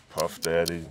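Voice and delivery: ordinary talk, nothing marked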